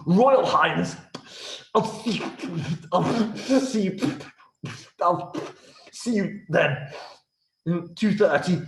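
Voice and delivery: high, halting, tongue-tied stutter; flustered courtier choking on his words; stammering nervous subject. Acted. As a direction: stumble over every word before the king. Nervous stammering visitor before royalty.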